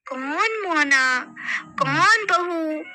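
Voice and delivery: slow, drawn-out spirit voice; slow eerie voice. Acted. Mohini mocking her mother-in-law, slow and eerie. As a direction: stretch each word like a spirit. Possessed woman denies identity.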